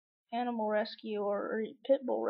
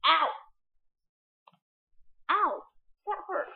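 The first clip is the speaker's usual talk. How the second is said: sharp high yelp of pain; startled ow; 5 semitones higher, 6 dB louder than usual. Real, not acted. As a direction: react to a sudden scratch. Cat scratched her.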